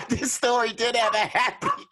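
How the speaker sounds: high, laughing through the words; real laughter breaking in. Real, not acted. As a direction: giggle it out. Laughing at comment.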